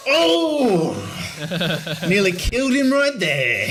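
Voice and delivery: high, loud outburst; mock outrage. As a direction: blurt it out high in exaggerated indignation. Mock outrage at the kid's insult.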